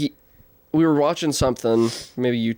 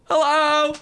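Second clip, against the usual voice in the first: high, blank hello; vacant high hello; 9 semitones higher than usual. Retold or imitated. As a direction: greet it dumbly, frozen.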